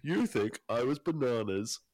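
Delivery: a hint of Clarkson; Clarkson impression. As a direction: drag out the punchline. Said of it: "enough bad accents today".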